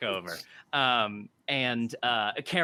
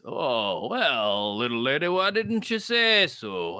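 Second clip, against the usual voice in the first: softened barkeep; 3 dB louder, slower than usual. Acted.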